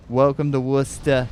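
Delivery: Boston welcome, r dropped in "Worcester"; Boston accent. Shown as a demonstration. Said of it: "the Boston type of accent".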